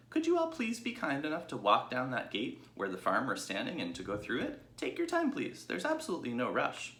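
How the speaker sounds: a sweet, well-mannered little pig; polite young pig. Acted. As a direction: Babe greeting the sheep, high and polite. Babe's voice.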